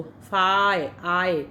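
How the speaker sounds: फाय and आय said as clipped separate syllables; isolated sound demo. Shown as a demonstration. Teacher splitting fire into sounds for students, slow and clear. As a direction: break the word into फाय, आय. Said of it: "इसको आई नहीं बोल रहे".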